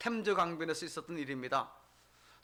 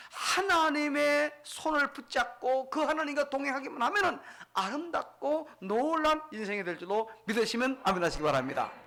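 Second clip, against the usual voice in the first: raised, ringing exhortation dropping at the close; forceful preaching; 7 semitones higher, 3 dB louder than usual. Real, not acted. Preacher urging the congregation to believe, forceful preaching. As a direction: preach it with conviction toward an amen.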